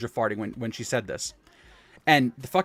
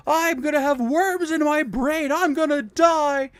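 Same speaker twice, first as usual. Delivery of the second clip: very high, loud, slow wail; terrified kid; 6 dB louder, slower than usual. Retold or imitated. Boy fearing worms in his brain, high loud wail. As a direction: wail it like a kid sure he is dying. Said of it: "He's like".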